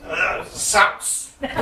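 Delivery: usual speaking voice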